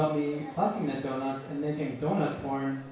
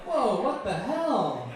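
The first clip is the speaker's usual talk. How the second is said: high, drawn-out mock bewilderment; taken aback; 6 semitones higher, 3 dB louder, slower than usual. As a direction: stretch out the stunned reaction. Reacting to being berated.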